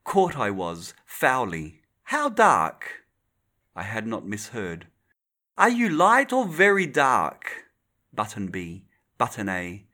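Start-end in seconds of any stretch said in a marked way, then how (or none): 2.1-3.1 high, genteel lady on the telephone; a landlady asking sharply, lipstick-coated; refined landlady probing on the phone; prim landlady voice. Acted. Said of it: "Voice, when it came, lipstick coated". 5.6-7.7 high, genteel lady on the telephone; a landlady asking sharply, lipstick-coated; refined landlady probing on the phone; prim landlady voice. Acted. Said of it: "Voice, when it came, lipstick coated".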